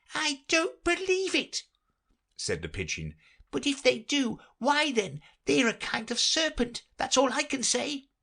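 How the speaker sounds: very high, fussy bird voice; fretful pigeon. Acted. The pigeon grumbling about serpents, very high fussy voice. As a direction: complain like a harried mother bird.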